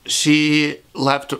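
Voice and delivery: choked up, words giving out into a sigh; crying. Real, not acted. Recalling the coworker who helped, choked up. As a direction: break off as real tears take over. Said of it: "end up crying like a little bitch".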